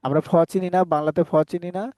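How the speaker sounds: ordinary delivery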